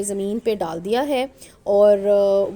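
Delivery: normal talking voice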